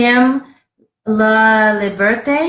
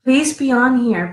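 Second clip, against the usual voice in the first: ordinary talk, nothing marked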